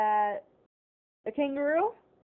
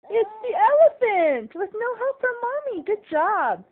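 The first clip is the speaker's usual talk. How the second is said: excited praise; 8 semitones higher, 5 dB louder, slower than usual. Real, not acted. Mother praising her toddler for finding the elephant, excited. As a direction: celebrate with big, drawn-out delight.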